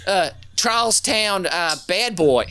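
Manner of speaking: put-on slow, drawn-out Boston accent. Trying a Charlestown Boston accent, slow and laughing. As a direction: lean on Charlestown like a local tough. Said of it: "a straight Boston".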